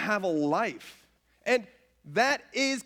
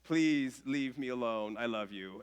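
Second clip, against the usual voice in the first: gentle tired plea; 8 semitones lower, 5 dB quieter than usual. Retold or imitated. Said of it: "getting ready to tell her".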